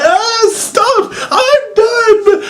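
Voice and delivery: very high hammed-up wail; mock Kane crying out. Vlogger mocks wrestler under extinguishers, shrill hammed-up cry. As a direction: squeal like a drenched wrestler begging them to stop. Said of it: "He's like".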